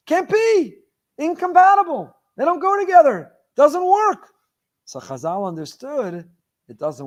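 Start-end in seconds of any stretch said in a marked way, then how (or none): 0.1-4.3 loud, high-pitched disbelief; incredulous protest. Retold or imitated. Rabbi voicing Yitzchak's rejection of the contradiction, incredulous. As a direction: fire off short, emphatic objections, voice way up. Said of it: "He was asking a question".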